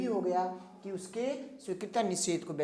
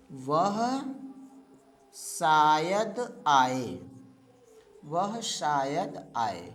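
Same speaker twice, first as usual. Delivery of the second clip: very slow, word by word, for students to write; unhurried classroom dictation; 6 semitones lower, much slower than usual. Shown as a demonstration. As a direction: spell it out slowly so they can copy. Example read out for note-taking.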